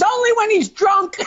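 wife's zinger. Acted. Wife answers cop.

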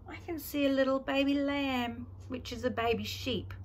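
gentle storytime voice for little ones; reading to a toddler. Acted. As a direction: read it like a picture book. Reading picture book to toddler.

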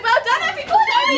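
high laughing shout; excited cheer. Real, not acted. Cheering the cast after the show.

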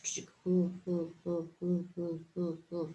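U repeated low and steady; sounding out U. Shown as a demonstration. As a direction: say U, U deep and rhythmic. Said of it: "sounding out the letter".

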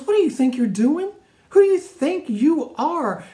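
high, loud scolding outburst; angry big brother. Acted. Older brother Eliab scolding young David, angry high outburst. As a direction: snap at him like a furious older brother. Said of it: "he got really angry with him".

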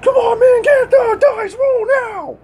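screaming at the dice; desperate shout. Real, not acted. Begging the dice roll.

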